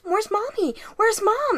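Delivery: high-pitched mock-panicked child; acted child's panic. Podcast host voices possessed Annie seeking her mother, acted high panic. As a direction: imitate a panicking girl, voice jumping high. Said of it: "she starts panicking".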